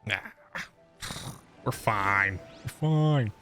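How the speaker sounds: usual speaking voice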